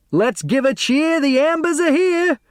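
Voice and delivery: high, bouncy cheer in a lighter voice; delivery boy cheering his arrival; cheery delivery boy. Acted. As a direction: sing it out like a happy delivery boy.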